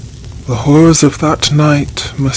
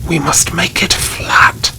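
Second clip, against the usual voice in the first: hoarse whisper; 2 semitones higher than usual. A narrator's reading. Baron treading the grave earth, hoarse whisper. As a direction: repeat it as a rasping whisper. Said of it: "the Baron shouted".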